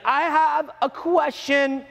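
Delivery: high, slow, drawn-out put-on voice; mock awkward questioner. Imitated student interrupting the seminar.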